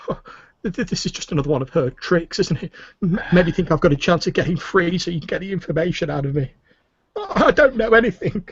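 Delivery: high, stammering tearful protest; sobbing prisoner. Acted. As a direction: plead like a distrustful captive near tears. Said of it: "sounds like he's sobbing quietly".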